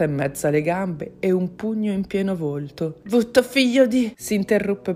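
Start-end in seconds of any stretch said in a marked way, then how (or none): 3.0-4.1 high, loud insult cut short; raised, furious swearing; angry curse. A narrator's reading. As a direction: curse the attacker mid-fight. Fighting woman curses, breaks off.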